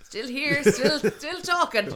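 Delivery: high, slow, weary cry; loud slow drawn out. Retold or imitated. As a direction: drag it out, exhausted and loud. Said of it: "I'm kinda like".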